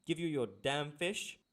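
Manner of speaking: hammed-up outrage at a customer; super angry fisherman. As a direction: demand it like a fed-up fisherman, dramatically. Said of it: "how I imagine, you know, the fisherman is feeling... super angry".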